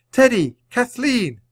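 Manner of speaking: each name high and loud; excited cry. A narrator's reading. Annie spots her friends, excited calling. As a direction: shout the names in delight. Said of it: "Annie cried".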